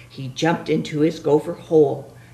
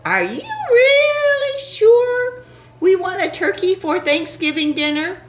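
put-on high pleading child voice. Little pilgrim pleading.

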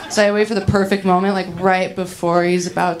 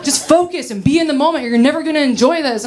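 higher, exasperated imitation of a scolding; mock mad boyfriend; 5 semitones higher than usual. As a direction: act out a fed-up lover's complaint. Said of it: "He gets so mad".

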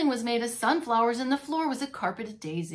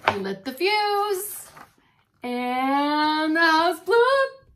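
slow, loud and higher; dramatic; 6 semitones higher, 8 dB louder, much slower than usual. A narrator's reading. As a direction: draw out the big bang. Dramatic explosion in story.

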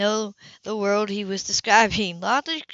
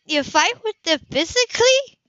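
higher, louder disbelieving woman; a woman who can't believe it; lifted, startled question; shocked Sybil; 12 semitones higher, 5 dB louder than usual. A narrator's reading.

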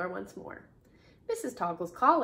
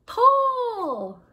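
high, drawn-out shout; shouted command; 13 semitones higher, 13 dB louder than usual. A narrator's reading. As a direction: holler the signal to a whole team. Principal gives the signal to pull.

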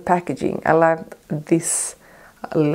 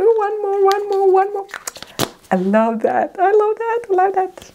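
very high squeal of one more; squealing excitement. Real, not acted. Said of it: "excited I am".

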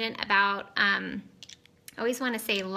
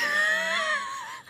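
sudden high cackle; high shrieking laugh. Real, not acted. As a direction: burst of squealing laughter. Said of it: "I'm laughing so hard".

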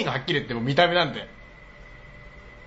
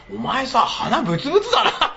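teasing laugh; 8 semitones higher than usual. Retold or imitated. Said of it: "みたいな".

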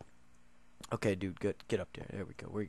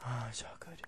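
whisper; 4 semitones lower, 18 dB quieter than usual. Real, not acted. Hushed self-reassurance.

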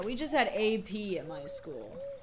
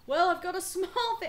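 put-on high, louder Polonius voice in an accent; put-on character accent; 9 semitones higher, 5 dB louder than usual. Trying a voice for Polonius, put-on high accent. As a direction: put on an exaggerated accent, pitched up. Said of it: "he wouldn't have that, that accent".

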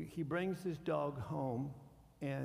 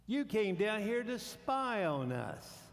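mock accusation; 7 semitones higher, 4 dB louder, slower than usual. Brothers' accusation retold.

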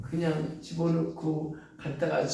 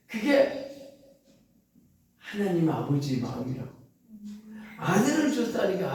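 usual speaking voice

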